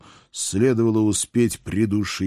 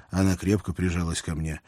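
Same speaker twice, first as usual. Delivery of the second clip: normal talking voice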